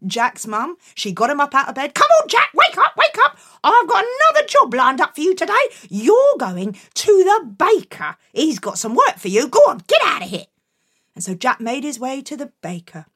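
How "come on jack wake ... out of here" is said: high, loud wake-up call; bossy mum. Acted. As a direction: chivvy him out of bed. Mum waking Jack.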